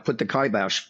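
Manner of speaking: usual speaking voice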